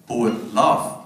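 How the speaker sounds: usual speaking voice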